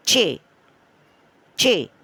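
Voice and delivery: चे said alone, clear and separate; pause, then a clear single चे; letter name drill. Shown as a demonstration. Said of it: "मेरे साथ दोहराएं".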